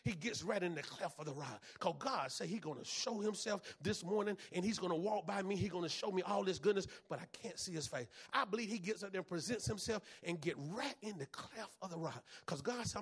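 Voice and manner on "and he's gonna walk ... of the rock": ordinary talk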